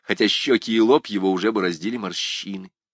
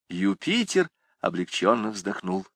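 ordinary delivery

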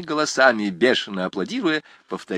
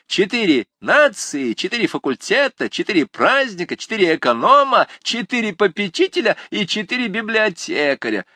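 mocking student; 11 semitones higher, 4 dB louder than usual.

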